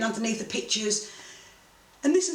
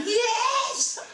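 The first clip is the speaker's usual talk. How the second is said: retold cheer. Celebrating the cash alone, high triumphant yell. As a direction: relive punching the air alone.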